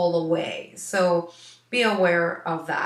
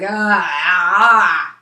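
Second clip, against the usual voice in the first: loud dog grunts; 11 dB louder, much slower than usual. Retold or imitated. Dog's noise imitated.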